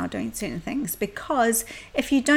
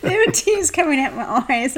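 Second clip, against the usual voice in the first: laughing through the story; 6 semitones higher, 5 dB louder than usual. Retold or imitated. Retelling crying during needling.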